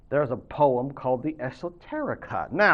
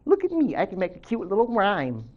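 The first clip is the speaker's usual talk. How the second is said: much higher, preening mock artist voice; mocking a vain artist; 5 semitones higher than usual. Teacher mocks art-for-art's-sake poets for her students. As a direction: lampoon a self-pleased poet.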